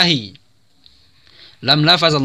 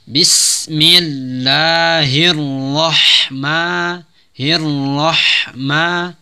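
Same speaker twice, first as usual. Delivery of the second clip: very slow melodic recitation of Bismillahirrahmanirrahim; Quran recitation; much slower than usual. Shown as a demonstration. Teacher models full recitation, slow melodic chant. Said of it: "Maka dibaca".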